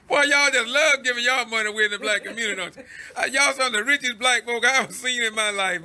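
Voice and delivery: bitter laughter breaks through the words; laughing while talking. Real, not acted. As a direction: laugh out the words, half crying. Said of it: "I mean, I'm laughing".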